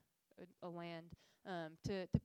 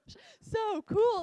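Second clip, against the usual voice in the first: much higher and louder, slow, laughing gosh so cool; delighted gush; 13 semitones higher, 16 dB louder, much slower than usual. Retold or imitated. Telling the audience how thrilled she was, high laughing gush.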